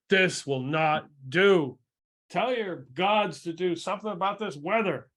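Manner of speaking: emphatic mayor laying down the law; indignant scolding. Acted. Mayor jabs the sage's chest, indignant scolding. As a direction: scold him firmly, driving the words home.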